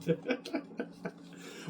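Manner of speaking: voiceless wheezy burst of laughter. Real, not acted. Speaker laughing at his own remark about actors crying, wheezy laugh. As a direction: laugh it out without voice.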